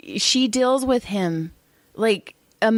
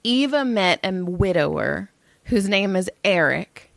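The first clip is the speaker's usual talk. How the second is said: ordinary delivery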